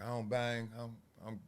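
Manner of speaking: nervous repeating. Retold or imitated. Comic as his scared teen self to the gangbanger, nervous and quiet. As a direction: stammer it out scared. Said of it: "Nervous and shit, repeating myself".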